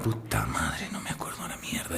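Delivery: hushed, breath-only groan of regret; whispered self-cursing. Acted. Hungover husband who cannot remember the night, whispered cursing. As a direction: curse under your breath, very soft and low. Hungover man muttering to himself.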